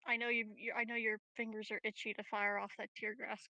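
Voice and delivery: normal talking voice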